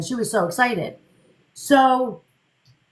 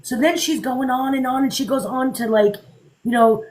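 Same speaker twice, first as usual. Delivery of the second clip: ordinary talk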